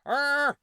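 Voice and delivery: a sudden high, loud "er"; mock his awkward stalled noise; a loud high blurted "er" like a stuck newsman; imitating Wallace's grunt. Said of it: "he ends up going".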